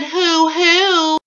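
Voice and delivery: high, drawn-out playful hoo-hoo; owl hoot. Pretend. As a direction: stretch it slowly like a hooting owl. Playful owl hoot after mentioning owls.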